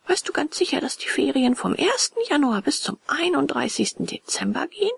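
put-on high, eager boy puppet questioning; wondering puppet boy. A narrator's reading. Puppet checks on endless holidays, high wondering voice. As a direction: ask in a high, hopeful boy's voice.

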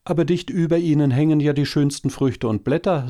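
hoch, schnell und erstaunt. A narrator's reading. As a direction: wundere dich als kleines Äffchen. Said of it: "sagte das Äffchen erstaunt". (Äffchen, erstaunt, hoch und schnell)

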